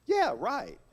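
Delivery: YEAH high, then RIGHT falling; varied inflection. Shown as a demonstration. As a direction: swoop "Yeah, right" up high and let it drop. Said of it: "four different ways".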